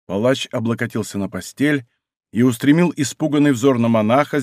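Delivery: ordinary delivery, nothing marked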